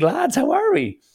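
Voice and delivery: high, chirpy greeting impression; hammed-up morning cheer, pitch soaring; top of the morning. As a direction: imitate a friend arriving full of beans. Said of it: "he was top of the morning stuff".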